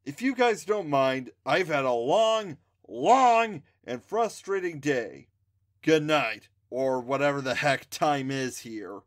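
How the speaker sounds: grumbling. A narrator's reading. Exhausted narrator complains.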